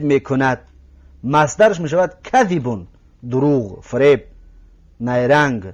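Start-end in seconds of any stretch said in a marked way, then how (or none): none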